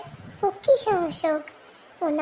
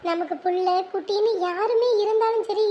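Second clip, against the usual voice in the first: usual speaking voice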